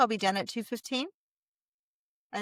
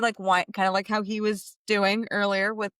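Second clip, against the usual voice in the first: normal talking voice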